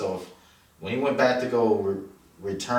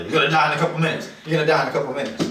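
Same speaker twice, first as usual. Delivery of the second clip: mock warning shout; 7 semitones higher, 5 dB louder, much faster than usual. Warning a screen character aloud.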